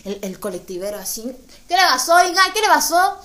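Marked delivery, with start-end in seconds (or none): 1.7-3.2 loud high shout over the bus; driver calling out. Retold or imitated. Bus driver calling back to ask, loud and high. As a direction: call back from the driver's seat.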